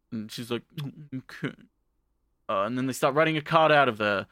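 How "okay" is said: very soft, reluctant reply; acted small, sheepish voice; meek agreement. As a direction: give in grudgingly, barely audible. Acted meek agreement to his terms.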